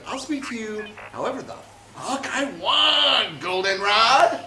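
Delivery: loud, high, drawn-out defiance. Acted. R2-D2 snapping back at C-3PO, defiant shout. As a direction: build to a slow shouted insult. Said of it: "R two D two is like".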